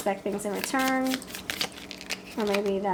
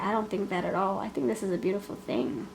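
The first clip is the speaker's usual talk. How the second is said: normal talking voice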